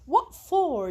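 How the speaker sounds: high, loud startled question; alarmed. A narrator's reading. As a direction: read it as an alarmed protest. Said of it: "began to sound alarmed". (بصوت مروّع ومنزعج)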